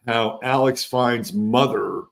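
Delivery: ordinary talk, nothing marked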